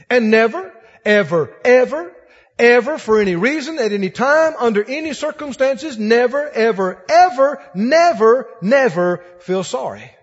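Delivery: NEVER, EVER hammered loud, each word its own beat; emphatic never. Real, not acted. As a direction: pound every never and ever.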